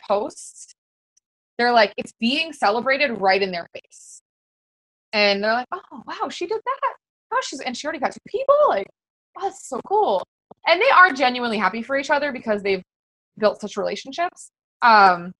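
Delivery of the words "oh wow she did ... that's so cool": mock amazed peer; impressed wow. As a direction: gush over a friend's success. Peers voiced reacting with awe.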